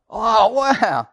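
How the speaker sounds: high mock-amazed wow; hammed-up wonder; imitating impressed radio bloke. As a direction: marvel like a spectator at fireworks. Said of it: "the bloke on the radio goes".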